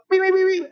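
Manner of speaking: very high, repeated beeps; imitated car horn. Taxi driver beeps round the curve, imitated horn. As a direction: mimic quick horn toots in a high voice. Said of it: "So he's just like".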